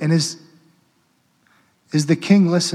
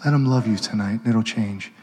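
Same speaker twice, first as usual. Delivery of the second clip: quiet pleading preaching; 2 semitones lower, 3 dB quieter than usual. Real, not acted. The priest urging listeners to accept love, softly pleading. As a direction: plead gently with the congregation.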